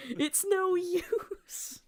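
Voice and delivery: very high, slow, loud yell; Silver battle cry. Pretend. Co-host joins in yelling the classic line, high and slow. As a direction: stretch it out like a game taunt. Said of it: "do you think both of you can do Silver and Blaze's voice".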